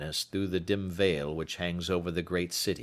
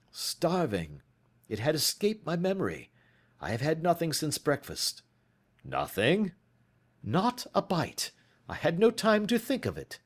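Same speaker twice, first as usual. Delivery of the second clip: higher, brisk detective; tired but brisk; lightly voiced weary Holmes; 8 semitones higher than usual. A narrator's reading. Holmes tells Watson he has not eaten.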